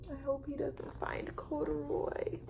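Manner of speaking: hushed worried aside; whisper. Real, not acted. Reader whispering her worry to young listeners. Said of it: "unvoiced whisper aside".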